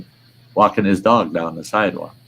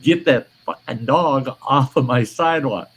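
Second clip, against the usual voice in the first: a firm, higher angry order; retold anger; 7 semitones higher than usual. Telling the neighbour off, angry order. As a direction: lay down the law. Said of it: "I said".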